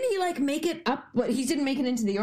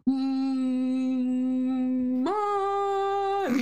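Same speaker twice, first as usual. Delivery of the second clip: put-on teasing kid. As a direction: tease a sibling with a long call. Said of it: "And you're like".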